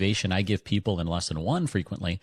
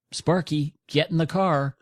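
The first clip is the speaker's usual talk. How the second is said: higher-pitched call to the dog, Sparky; 7 semitones higher, slower than usual. Retold or imitated. Owner calling dog into the car, higher command. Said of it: "and you say".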